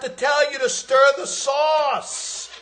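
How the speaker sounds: yelling Italian mobster at Karen; high, loud, exasperated shouting at Karen; nagging partner. Retold or imitated. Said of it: "Remember in Goodfellas?".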